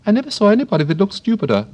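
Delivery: high, fast and sharp; sudden violet voice. Acted. A violet suddenly insults Alice, high and fast. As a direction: startle the girl with it.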